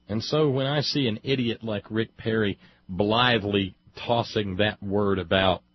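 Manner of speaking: usual speaking voice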